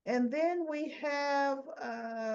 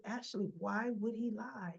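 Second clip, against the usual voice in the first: reasoning; 9 dB quieter than usual. Acted. Tracy reasoning with frazzled Ashley, acted soft and reasonable. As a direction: gently question the frazzled friend.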